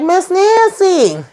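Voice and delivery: very high, slow drawn-out name; exasperated. Retold or imitated. Sharon scolding Miss Nancy, drawn-out and very high. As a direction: drag her name out in disbelief.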